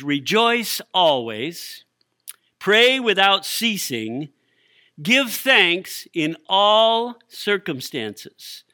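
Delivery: slow, weighty reading of each command; slow scripture reading. Acted. Preacher to congregation, slow solemn scripture reading. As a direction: let each command land slowly.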